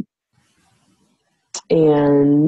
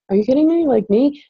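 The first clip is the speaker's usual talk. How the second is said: mock disbelief; 10 semitones higher, 4 dB louder than usual. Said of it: "And I'm like".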